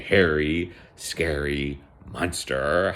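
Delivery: slow, drawn-out spooky narration; storybook monster build-up, slowly; ominous storytelling. A narrator's reading. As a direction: stretch each word to build mock menace.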